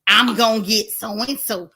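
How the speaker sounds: a hint of mimicking his put-on voice; mock quoting the host; mocking his imitation. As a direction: echo his fake accent with scorn.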